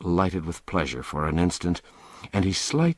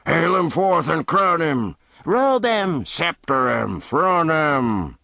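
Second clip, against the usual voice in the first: higher, louder, jeering shouts; rowdy cries; 9 semitones higher, 4 dB louder than usual. Acted. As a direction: bark out mock orders. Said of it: "these and twenty other cries".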